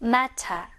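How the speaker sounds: "matter" as "mat-tah"; British pronunciation. Shown as a demonstration. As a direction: sound the t and drop the r in "matter". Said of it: "이렇게 발음이 되겠구나".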